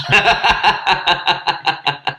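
loud cackling laugh; burst of laughter. Real, not acted. Laughing at the pub story.

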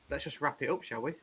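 ordinary talk, nothing marked

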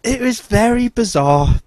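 high, slow, drawn-out bizarre; lingering amazed emphasis; incredulous. Real, not acted. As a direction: stretch out the disbelief.

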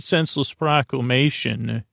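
ordinary delivery

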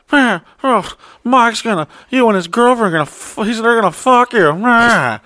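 high, panicky imitation of the employee; mock him sputtering in a high voice; whiny freakout. Imitating his employee's high panicked whine.

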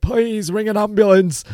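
high, strained plea for an ambulance. Retold or imitated. Panicking on the motorway, begging the lad to phone, high and strained. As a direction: beg in a high, desperate retold voice. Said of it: "I sound like [name] there".

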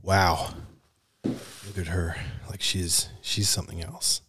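low, quiet American drawl; American accent. Shown as a demonstration. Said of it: "I can do American".

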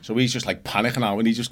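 usual speaking voice

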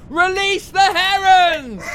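loud exclamation. Acted. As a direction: bellow the correction, stretched out. Mocking villain's mistaken command.

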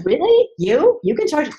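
high, loud sneering disbelief; mocking gremlin. The inner gremlin mocking you in disbelief, high and loud. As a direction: scoff at yourself like the gremlin would. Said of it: "Because you're like".

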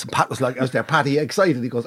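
usual speaking voice

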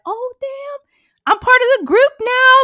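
high, thrilled squeal of joy; mock delight. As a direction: act overjoyed at being included.

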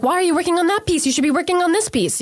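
high, quick imitation of an angry boss; retold yelling.

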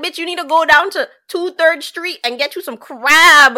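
loud, high, emphatic urging; excited best friend. Acted. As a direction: gush a must-try tip to your bestie. Voicing a pushy excited friend.

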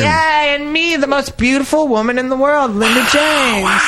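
high, grand self-introduction; hammed-up diva intro. Cohost introduces herself as a diva. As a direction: announce yourself like a star.

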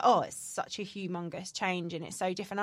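usual speaking voice

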